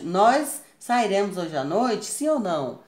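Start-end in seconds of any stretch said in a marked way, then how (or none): none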